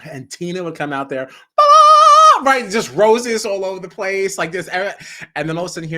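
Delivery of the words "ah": very high, loud belted ah; loud, high operatic ah; belted high note. Retold or imitated. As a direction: belt the note like a diva entering. Imitating singer's big note.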